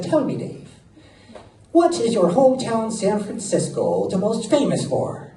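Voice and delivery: put-on high-pitched leprechaun of an old Irishman; awful Irish accent. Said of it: "I sound like a leprechaun".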